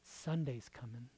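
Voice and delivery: quiet, low Sunday's coming; hushed promise; soft Sunday's coming. Shown as a demonstration. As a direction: whisper the promise. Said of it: "repeat soft then loud".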